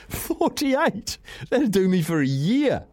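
incredulous laugh. Real, not acted. Disbelief at can limit.

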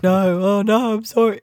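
high, loud mock apology from a broken orc; mock remorseful orc. A betrayed troll general muttering apologies, mock pleading. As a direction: play the guilty troll, lightly pleading. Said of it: "muttering to himself and kind of being like".

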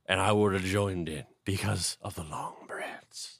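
slow, breathy mock whisper. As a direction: whisper the punchline, slowly. Comic aside about long bread.

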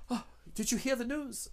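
high, hushed gossip voice; a woman passing on the news. Acted. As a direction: lean in and share the news in a high, soft voice. GM voicing gossiping women.

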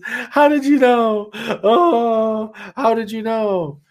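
high-pitched, drawn-out mock sobbing; imitated crying. Tearful woman to missionaries at her door, imitated high wailing. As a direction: wail it slowly, as if in tears. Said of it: "she's just crying and crying".

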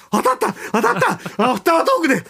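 high-pitched, elated replay of inner cheering; joyful excitement. Retold or imitated. Host acting out his delight at the prediction coming true, excited. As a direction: re-enact a thrilled "it hit!" reaction. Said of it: "あれが当たったと思って。っていう喜びも".

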